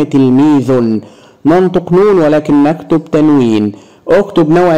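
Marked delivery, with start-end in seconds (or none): 0.0-1.0 تلميذ slowly as tilmiidhun; damma tanween. Shown as a demonstration. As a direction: end تلميذ in "un". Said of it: "وبالضم".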